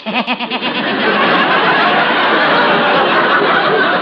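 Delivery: long high cackling laugh; cackle. Acted. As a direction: cackle like an old goat. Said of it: "listening to that cackle".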